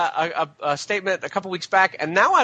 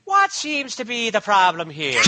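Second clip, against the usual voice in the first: higher, slow Irish policeman voice; Irish cop key line; 4 semitones higher, slower than usual. Shown as a demonstration. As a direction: stretch it out as a stock Irish cop. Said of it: "my key line on that voice".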